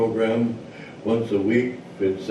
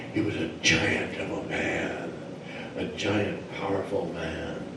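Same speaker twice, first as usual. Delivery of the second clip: low, soft description of a giant; hushed storytelling; 4 semitones lower, 8 dB quieter than usual. A narrator's reading. Storyteller introduces the giant mosquito to listeners, hushed.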